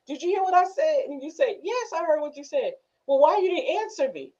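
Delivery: mock questioning. Acted quarrel over not listening.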